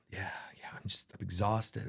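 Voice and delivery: worn-out son. Retold or imitated. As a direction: whisper it like an exhausted boy. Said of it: "He's like".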